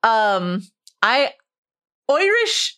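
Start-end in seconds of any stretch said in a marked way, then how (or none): 2.1-2.7 Oirish pitched high in a hammed-up stage-Irish voice; mock Irish accent. Host repeating the emailers' complaint, hammed-up Irish accent. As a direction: toss off Oirish like a leprechaun. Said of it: "accents of the Harfoots".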